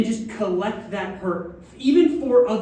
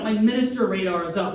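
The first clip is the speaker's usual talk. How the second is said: usual speaking voice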